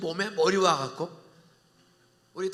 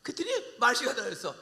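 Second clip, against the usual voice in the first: ordinary talk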